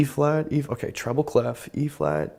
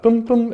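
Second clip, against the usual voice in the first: sung bass notes; 14 semitones higher, 6 dB louder than usual. Shown as a demonstration. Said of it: "the B flat".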